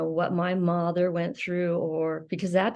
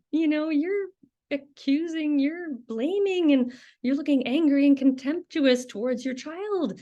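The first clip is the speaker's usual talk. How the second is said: high-pitched imagined telling-off; indignant confrontation; 6 semitones higher than usual. Pretend. Nanny wanting to confront that mother, indignant. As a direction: turn the scolding back, voice pushed up high. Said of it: "turn around to that mother and say".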